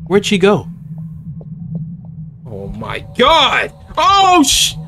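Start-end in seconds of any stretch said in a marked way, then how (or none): 2.5-4.7 startled panic. Real, not acted. Caught by the monster.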